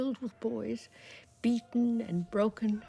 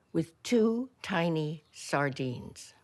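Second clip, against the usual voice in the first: ordinary delivery, nothing marked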